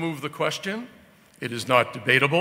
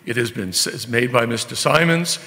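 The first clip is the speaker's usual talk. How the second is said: usual speaking voice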